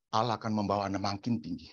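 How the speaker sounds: normal talking voice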